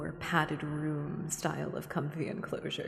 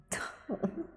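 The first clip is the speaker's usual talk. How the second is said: airy giggle; 11 semitones higher, 4 dB quieter than usual. Real, not acted. Laughs after whisper joke.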